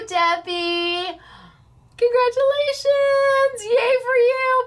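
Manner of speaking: showering a winner with a cheer; sing-song congratulations. Acted. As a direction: sing out the win. Celebrating winner announcement.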